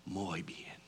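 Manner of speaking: unvoiced whisper, very soft; whispered Spanish approval. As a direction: breathe it out like a lullaby. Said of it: "he said".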